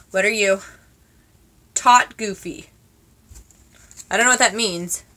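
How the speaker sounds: usual speaking voice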